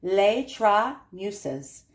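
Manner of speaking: Les Trois Muses sounded out very slowly in French; careful French. Shown as a demonstration. Collector braving a French name for viewers, very slow careful French. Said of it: "see, I'm trying".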